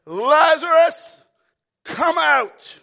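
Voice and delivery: big commanding call, drawn-out name; Jesus shouting into the tomb; loud shout. Acted. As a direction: call him out of the tomb loudly. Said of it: "he shouted in a loud voice".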